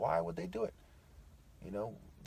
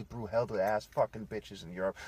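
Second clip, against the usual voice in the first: ordinary talk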